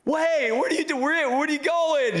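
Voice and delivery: high, mock-alarmed calling out; imitating a frantic girlfriend; imitated girlfriend calling. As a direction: call after someone running off, high-pitched. Girlfriend calls after him fleeing.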